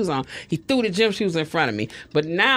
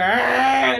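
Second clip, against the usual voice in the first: higher-pitched imitated cry; hammed-up crying sound; mock crying wail; 5 semitones higher, 3 dB louder than usual. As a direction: wail out a put-on sob. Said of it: "started crying".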